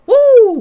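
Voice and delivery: very high, loud woo; ecstatic whoop. Real, not acted. Preacher carried away by the verse, loud high whoop. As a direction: shout for joy.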